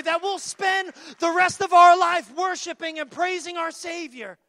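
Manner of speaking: high, loud, fervent preaching; preaching climax. Acted. Preacher to congregation, high and loud fervent climax. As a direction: lift it to a shouting peak.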